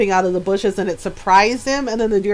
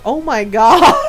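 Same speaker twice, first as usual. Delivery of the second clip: laughing; 6 dB louder than usual. Retold or imitated. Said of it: "Ron is laughing, going".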